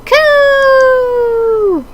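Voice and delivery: a small dove charging in; brave pigeon character. Acted. Pigeon Oka-san leaping to stop Ryota with a high cry.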